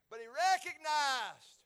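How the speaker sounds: slow, high and loud on recognized; drawn-out preacher emphasis; emphatic preaching. Real, not acted. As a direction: swell the voice on recognized.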